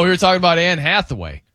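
usual speaking voice

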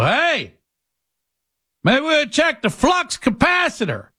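very high, silly busybody voice; hammed-up high goofy suggestion; clueless know-it-all. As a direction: pipe up as a clueless amateur mechanic. Plays clueless customer advising the mechanic.

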